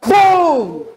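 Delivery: loud, high exclamation; boom. Acted. Preacher jolting the congregation with a loud boom. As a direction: punch it out like an explosion.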